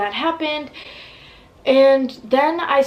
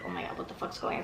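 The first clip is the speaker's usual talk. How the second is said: whispered in shock, much softer; panicked whisper; 2 semitones lower, 13 dB quieter, much faster than usual. Retold or imitated. Seeing her mom's face, whispering in panic. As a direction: breathe it out in horrified disbelief. Panicking as baby stays silent.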